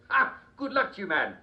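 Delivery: hammed-up royal grievance; upset outburst. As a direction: fume about the rabbits eating his lettuce. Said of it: "you do sound a little upset".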